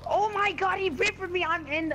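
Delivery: high, loud outraged cry; outrage at getting killed; raging gamer. Real, not acted. Raging after dying.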